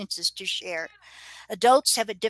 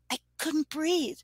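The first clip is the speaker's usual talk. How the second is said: put-on a slow, higher child voice; slowly; 6 semitones higher, 4 dB quieter, slower than usual. A narrator's reading. Drowned girl recalling to her nurse, speaking slowly. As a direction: let each word come slowly. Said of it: "speaking slowly".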